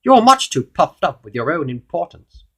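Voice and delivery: high, loud royal scolding; goblin king. A narrator's reading. King rebuking puffed-up Mister Uppity, loud regal voice. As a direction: lay down judgement as a king. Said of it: "he continued".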